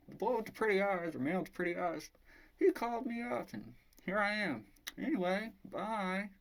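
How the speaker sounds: high-pitched, chatty girl impression; dog sitter. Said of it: "And she's like".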